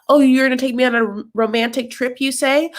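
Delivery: high mock-incredulous voice; mocking Kalani. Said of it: "She's like".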